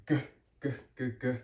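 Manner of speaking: "G" as a bare guh sound; hard G. Shown as a demonstration. Teacher showing learners the English G sound, "G" said as hard guh. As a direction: repeat "G" as short hard guh bursts. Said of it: "English G sound".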